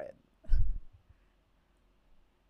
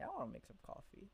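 quiet inner thought; 7 semitones higher, 14 dB quieter, faster than usual. Retold or imitated. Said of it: "I started thinking".

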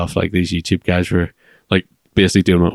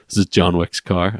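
usual speaking voice

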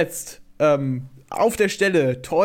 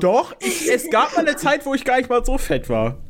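high-pitched protest; 9 semitones higher than usual. Real, not acted. Defensive protest, voice jumps high.

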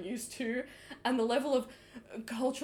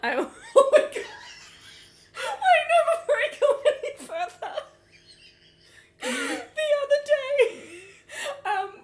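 laughing; 11 semitones higher, 6 dB louder, slower than usual. Real, not acted. Laughing while telling a story.

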